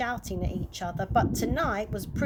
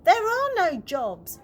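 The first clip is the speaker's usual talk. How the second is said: pleading dad; 7 semitones higher, 5 dB louder than usual. A narrator's reading. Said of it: "pleaded Dad".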